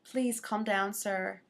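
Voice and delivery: low, quiet mock plea; hushed plea. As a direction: quietly imitate a plea for calm. Acting out lady pleading with driver.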